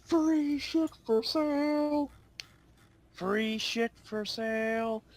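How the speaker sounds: high, slow drawn-out hawker cry; vendor yelling. Retold or imitated. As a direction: call it out like a market seller. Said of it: "you can hear him yelling".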